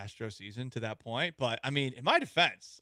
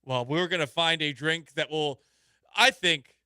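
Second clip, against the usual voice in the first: radio tease; 4 semitones higher, 7 dB louder, slower than usual. Acted. Show tease read.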